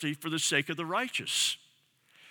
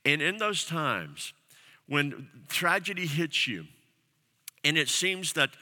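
ordinary talk

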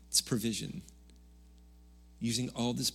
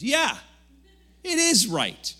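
Jonah snapping back, much higher and louder; defiant shout; 7 dB louder, slower than usual. Acted. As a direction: yell it back stubbornly at God. Jonah defiantly answers God.

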